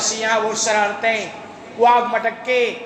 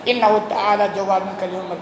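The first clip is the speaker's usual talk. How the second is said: measured, lower chanted closing benediction in Arabic; Arabic prayer recitation; 5 semitones lower than usual. Acted. Preacher closes the sermon for his listeners, reciting the Arabic blessing. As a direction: intone the closing blessing like a liturgy.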